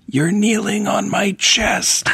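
high, slow, strained dying voice; gasping dying man. Retold or imitated. A Get Smart victim gasping to Maxwell Smart, high and slow. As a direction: wheeze it out like a man gasping for breath. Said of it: "someone would be lying there gasping for breath".

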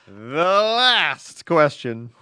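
LAST stretched out long and loud; grand announcement. Pretend. Co-host announcing the end of the letters, grand drawn-out announcement. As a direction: draw out "The last question" slowly, punching LAST. Mock announcing the final question.

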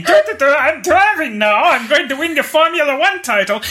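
high, loud hammy Austrian racer; Austrian accent. Retold or imitated. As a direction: ham up an Austrian driver. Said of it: "the terrible accent".

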